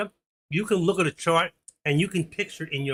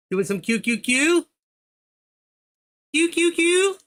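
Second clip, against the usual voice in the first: rising to a much higher, louder teasing squeal; teasing rising question; 13 semitones higher, 4 dB louder, slower than usual. Pretend. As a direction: tease him, climbing higher each time. Taquine un auditeur sur QQQ.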